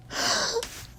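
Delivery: sharp high intake of breath; delighted gasp. Real, not acted. Fan spots the perfect character match, delighted gasp.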